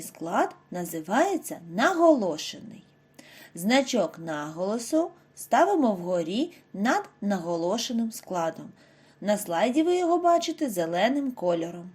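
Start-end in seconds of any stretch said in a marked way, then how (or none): none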